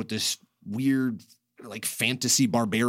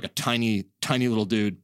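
ordinary talk, nothing marked

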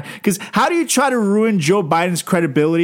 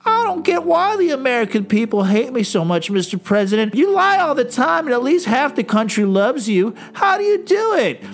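higher, mock-whining appeal to the president; a pleading senator, squeaking up at the end; whiny Lindsey Graham; 6 semitones higher than usual. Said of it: "It's like".

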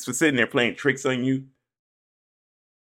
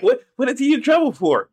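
worried mom; 10 semitones higher, 4 dB louder than usual. Retold or imitated. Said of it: "Your mama be like".

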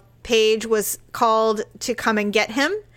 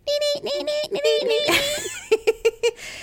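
high laugh. Real, not acted. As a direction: burst of high-pitched laughter. Laugh after mock headline.

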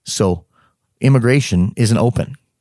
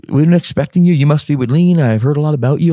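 cheerful officer; 4 semitones higher than usual. Retold or imitated. Overly cheerful officer greeting.

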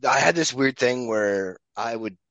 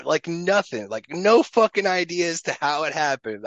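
ordinary delivery

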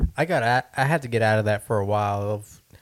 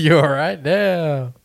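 slow laughing question; 4 semitones higher, 4 dB louder, slower than usual. Real, not acted. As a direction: laugh through it. Laughing after the sneeze.

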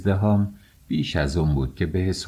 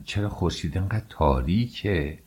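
usual speaking voice

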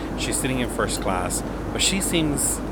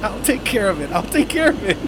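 flustered reassurance; 10 semitones higher, faster than usual. Retold or imitated. Flustered attendant answering cockpit.

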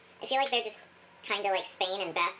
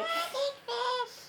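silly high voice; 14 semitones higher, much slower than usual. Pretend. Silly aside voice.

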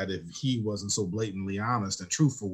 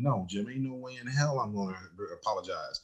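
muttered stubborn refusal; 9 dB quieter than usual. Retold or imitated.

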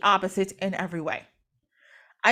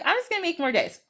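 higher, playful sly tone; cheeky goddess voice; 8 semitones higher, faster than usual. Retold or imitated. Nut outsmarting Atem's decree, playful higher voice. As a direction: tease it like a clever loophole. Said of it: "She's like".

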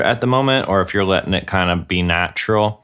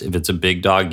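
ordinary talk, nothing marked

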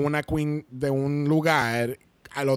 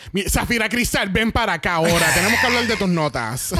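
imitating a telling-off, pitched up; mock scolding; 4 semitones higher than usual. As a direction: scold playfully, like summoning someone in trouble. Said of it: "como si estuviera regañándola".

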